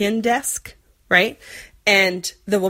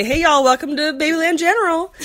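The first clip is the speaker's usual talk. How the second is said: high, sing-song Southern nurse greeting; Southern accent; 9 semitones higher, 3 dB louder than usual. Retold or imitated. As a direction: play a cheery Southern receptionist. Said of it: "also gonna do some Southern accents".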